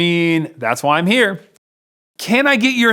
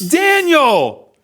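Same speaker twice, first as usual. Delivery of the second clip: big reveal; 8 semitones higher, 3 dB louder than usual. Acted. After drum roll.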